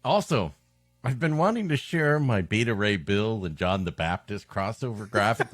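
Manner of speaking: ordinary talk, nothing marked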